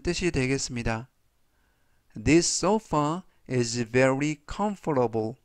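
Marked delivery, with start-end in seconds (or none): 2.1-5.4 very slow, careful English sentence; slow model reading. Shown as a demonstration. Teacher reading slowly for students to repeat. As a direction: spell it out slowly for learners. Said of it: "제가 역시 천천히 세 번씩 읽어드리고요".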